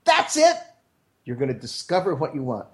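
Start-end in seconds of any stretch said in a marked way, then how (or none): 0.0-0.5 high-pitched eureka cry; imitated flash of discovery; sudden realisation. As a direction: act out the moment it clicks. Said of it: "someday something's gonna go".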